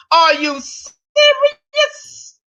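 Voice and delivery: slow, stretched incredulous cry; are you serious, drawn out; mock disbelief. Real, not acted. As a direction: drag it out in disbelief. Catchphrase, very slow.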